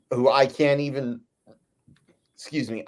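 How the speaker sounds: usual speaking voice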